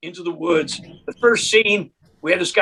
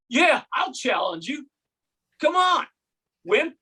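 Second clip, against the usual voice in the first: put-on drunk heckler; 6 semitones higher, slower than usual.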